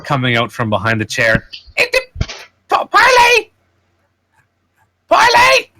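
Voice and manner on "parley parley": very high, loud creature squeal; piercing little squeaky cry; small squeaking voice. Acted. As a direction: squeak it out like a tiny cornered critter. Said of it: "you hear in a small squeaking voice".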